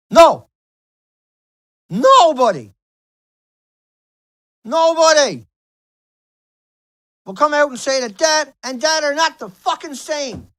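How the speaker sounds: furious yelling rant; real angry outburst; angry shouting. Real, not acted. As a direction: blast it out, fed up with everyone. Ranting furiously at the world.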